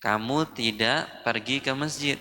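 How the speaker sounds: normal talking voice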